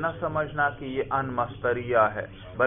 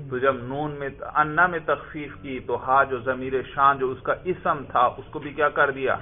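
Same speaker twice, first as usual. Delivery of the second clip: normal talking voice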